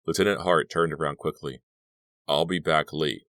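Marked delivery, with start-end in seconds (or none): none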